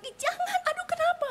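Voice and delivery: very high-pitched panicked cry, acted out; hammed-up alarm, voice leaping way up; startled shriek. Wife waking to find her husband attempting suicide, startled shriek. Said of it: "Dia kaget, dia bilang".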